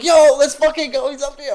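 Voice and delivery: high, loud hyped yell; excited fanboy cheer. Retold or imitated. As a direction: cheer like you just spotted your idol. Fanboying as K Money joins.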